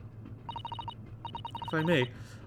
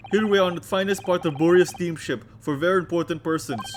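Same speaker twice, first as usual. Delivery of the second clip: put-on loud, heavy Russian accent; Russian crewman; 4 semitones higher, 8 dB louder than usual. Crewman introducing himself to visitors, loud Russian accent. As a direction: boom it out like a proud Russian sailor. Stroganoff voice again.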